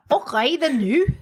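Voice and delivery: Scottish accent. Shown as a demonstration. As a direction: put on a playful Scottish accent. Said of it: "I can do Scottish".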